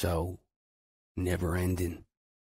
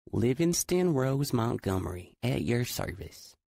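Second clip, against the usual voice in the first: put-on thick Southern accent; 6 semitones higher than usual. A narrator's reading. Girl introducing herself to Adam, thick Southern accent. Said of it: "in a thick Southern accent".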